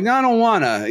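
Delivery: high whiny mock refusal; childish high no, I don't wanna; petulant mumbly refusal. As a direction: brush it off like a sulky emperor. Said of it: "And he's like".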